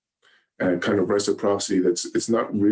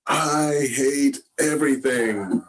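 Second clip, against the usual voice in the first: drawn-out, higher-pitched mock wail of frustration; retold cry out; 6 semitones higher, slower than usual. Speaker retelling an upset cry over broken marble blocks, mock outcry. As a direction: imitate an upset child crying out, slow and pitched up. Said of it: "I hear him cry out".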